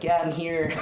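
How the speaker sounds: high, laughing put-on voice; squeaky fake voice through a laugh; silly high voice. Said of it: "with this fucking voice".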